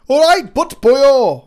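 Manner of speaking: put-on loud, slow Northern accent drifting Welsh; hammed-up Northerner, pitched high and drawn out; Welsh-sounding attempt at a Northern accent; Northern English accent. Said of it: "I'll do an accent for the North".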